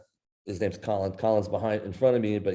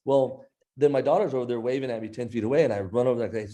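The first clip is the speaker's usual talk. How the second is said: ordinary talk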